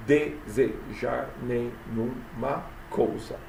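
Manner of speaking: "Desejar nenhuma coisa" very slow, each syllable apart. Shown as a demonstration. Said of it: "até a sílaba tônica". ("Desejar nenhuma coisa" escandido, bem devagar)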